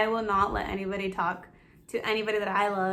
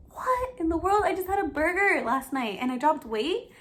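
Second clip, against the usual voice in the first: amazed disbelief; 7 semitones higher than usual. Retold or imitated. Seeing she lost weight after a burger, amazed. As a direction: replay the shock of the scale. Retelling shock at scale.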